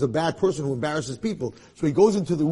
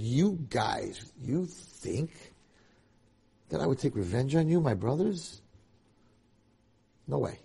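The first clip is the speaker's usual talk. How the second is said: tender reassurance; 2 semitones lower, 7 dB quieter than usual. Acted. Yosef to his brothers, softly reassuring. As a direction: hush it like soothing family.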